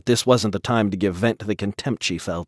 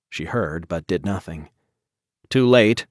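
usual speaking voice

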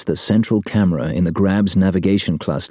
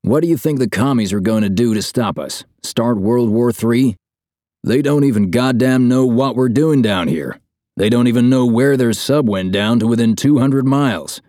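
higher-pitched, scornful rhetorical questioning; scathing; 5 semitones higher, 3 dB louder than usual. A narrator's reading. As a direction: rant dismissively at a worrier, pitched up. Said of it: "His tone is scathing".

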